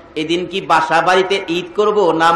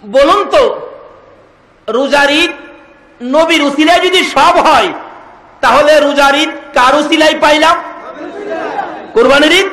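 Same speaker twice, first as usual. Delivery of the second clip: high, loud questions to the congregation; full-force preaching; 8 semitones higher, 5 dB louder than usual. Acted. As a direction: fire up the crowd for an answer.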